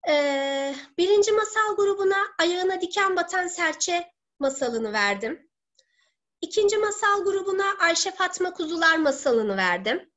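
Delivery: ordinary delivery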